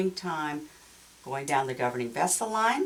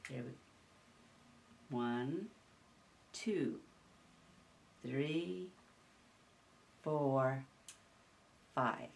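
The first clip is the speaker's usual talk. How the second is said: quiet, slow counting; soft counting; 4 semitones lower, 10 dB quieter, much slower than usual. Real, not acted. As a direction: count gently under your breath. Counting passes during massage.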